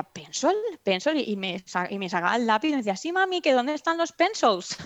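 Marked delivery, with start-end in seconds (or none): 0.0-1.2 pencil asked back higher, questioning; puzzled mother. Retold or imitated. Mother echoes her daughter's odd word, puzzled. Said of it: "Y yo decía". 3.0-4.8 put-on child voice. Daughter voiced asking for pencils.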